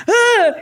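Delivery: sharp high loud "ah" of disgust; cringing yelp; cringing cry. Retold or imitated. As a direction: recoil with a cry. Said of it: "So I went".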